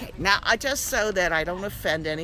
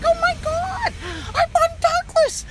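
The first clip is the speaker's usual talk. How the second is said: very high, loud, astonished; amazed shriek; 6 dB louder than usual. Retold or imitated. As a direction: squeal it like finding out you live next to treasure. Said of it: "I'm like".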